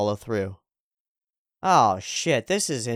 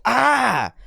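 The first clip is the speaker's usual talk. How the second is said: retold scream, much higher and louder; demonstrating a primal release scream; full shout; 12 semitones higher, 7 dB louder than usual. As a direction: let out a full-throated yell. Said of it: "I screamed as loud as I could".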